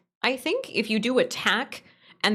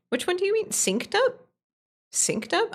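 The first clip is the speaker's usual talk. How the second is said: high confused questions; 11 semitones higher than usual. Real, not acted.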